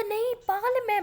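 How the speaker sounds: loud, high indignant outburst; outraged denial. Acted. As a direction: bark out the denial as if insulted. Uncle denies teasing, outraged.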